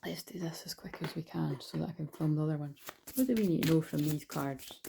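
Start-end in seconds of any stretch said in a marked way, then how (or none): 0.0-2.8 whispering. Real, not acted. Rushing through to film more.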